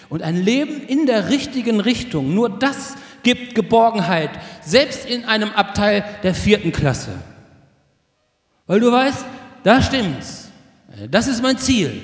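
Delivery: forceful preaching. Real, not acted. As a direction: proclaim it with full conviction. Predigt mit voller Kraft. (kraftvoll und überzeugt gepredigt)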